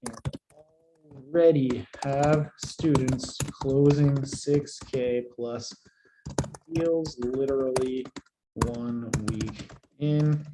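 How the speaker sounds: slow, soft dictation of a post; dictating while typing. Acted. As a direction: read each phrase out as you type it.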